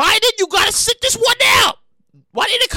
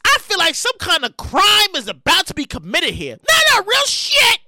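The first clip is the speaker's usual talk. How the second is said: normal talking voice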